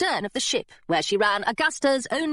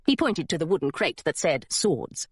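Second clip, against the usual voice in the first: plain reading voice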